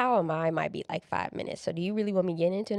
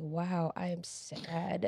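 deadpan quiet; 3 semitones lower, 7 dB quieter, much slower than usual. Retold or imitated. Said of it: "and I was like".

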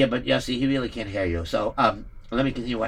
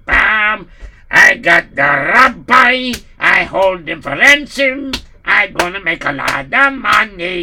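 gloating villain; 2 semitones higher, 8 dB louder, slower than usual. Acted. Pirate gloating after meal.

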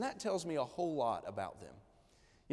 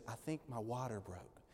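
quiet retelling; 5 semitones lower, 12 dB quieter than usual. Retold or imitated. Wife calls about her water.

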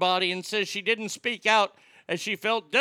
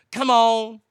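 louder, higher exasperated come on; 4 semitones higher, 7 dB louder than usual. Real, not acted. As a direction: throw up your hands, come on.

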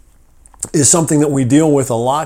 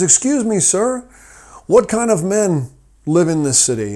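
much higher, louder polite question; a sharply higher-voiced stranger asking politely; second traveler asking; 7 semitones higher, 4 dB louder than usual. Second traveler asks the same question.

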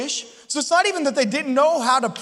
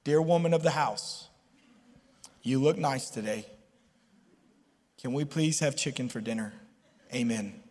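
lower, softer formal prayer tone; stiff prayer voice; 10 semitones lower, 8 dB quieter than usual. Retold or imitated. Husband addressing wife like a formal prayer. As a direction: pray at your wife solemnly. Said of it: "the way you talk to God".

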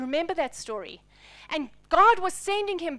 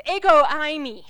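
ringing louder declaration of Ego eimi; proclaimed loudly; 10 dB louder than usual. Acted. Preacher to congregation, proclaiming "Ego eimi" loudly. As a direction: announce "Ego eimi" as a solemn title.

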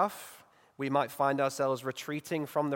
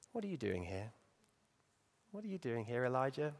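much softer, tender question; gentle God; 9 dB quieter than usual. Acted. God gently asking Elijah what he is doing there. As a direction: ask it softly, like a patient father.

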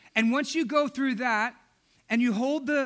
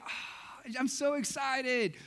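a breathy, slowed gasp of delight; breathy excited gush; 2 semitones higher, 6 dB quieter, slower than usual. Retold or imitated. Wife gushing over her pregnancy, breathy and excited. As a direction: gush like a thrilled mother-to-be. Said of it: "she was so excited".

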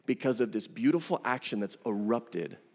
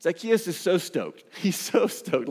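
1 delighted, laughing; 6 semitones higher than usual. Real, not acted.

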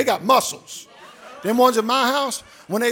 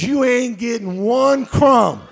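defiant dog; 4 semitones higher, 9 dB louder than usual. Retold or imitated. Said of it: "D J says".

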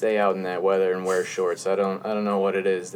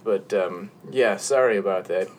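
usual speaking voice

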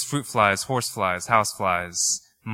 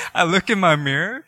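usual speaking voice

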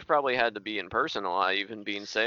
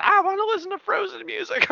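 put-on high, whining little kid voice; whiny child. Dad mimics middle child whining during governor call. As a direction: mimic the toddler begging for Frozen. Child heard screaming in background.

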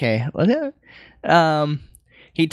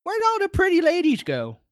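put-on high, indignant old-man voice; high-pitched ornery geezer; cranky Master Roshi; 9 semitones higher than usual.

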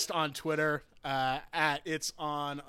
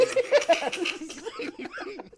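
big high laugh. Real, not acted. Laughing at the tweet.

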